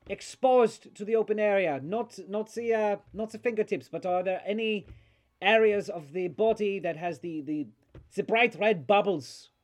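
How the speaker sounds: put-on high, loud voice with a thick accent; alarmed accented doctor. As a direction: question urgently as an eccentric foreign doctor. Game master voices accented doctor.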